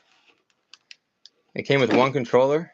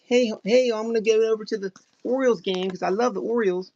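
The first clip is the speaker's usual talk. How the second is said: high, mocking Maryland accent of her younger self; exaggerated Maryland accent; 10 semitones higher than usual. Mocking her childhood Maryland accent for the chat, Maryland accent. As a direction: send up her own old Maryland accent. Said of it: "my Maryland accent is so awful".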